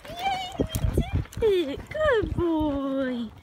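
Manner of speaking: loud, high cheer for the horse; excited praise. Real, not acted. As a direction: praise him like he just won. Horse clears the barrel.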